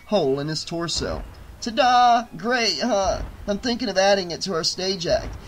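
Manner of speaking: high-pitched, friendly showing off; cheerful dead showman. Acted. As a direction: show off a trick with glee. Plays Tony, cheerful dead performer.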